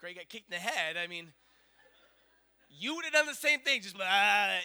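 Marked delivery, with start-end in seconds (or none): 2.7-4.6 exasperated. Real, not acted. Defending himself to audience.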